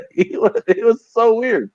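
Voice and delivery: high-pitched, breaking up with laughter; laughing. Real, not acted. Telling co-hosts about a weird coworker, laughing. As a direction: say it through real laughter.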